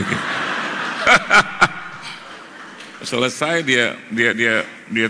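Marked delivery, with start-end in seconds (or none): 0.3-2.3 unvoiced laugh after the punchline; preacher laughing at his own story; breathy laugh. Real, not acted. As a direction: laugh at your own joke.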